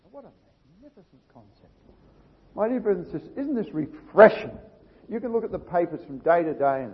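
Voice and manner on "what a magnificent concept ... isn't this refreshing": normal talking voice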